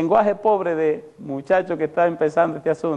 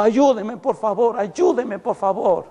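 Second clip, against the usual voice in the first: higher-pitched mock yelling, no real volume; retold cries for help; 5 semitones higher than usual. Preacher voices possessed woman begging him, mild retold yell. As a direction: imitate a woman pleading for help. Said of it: "ella me gritaba".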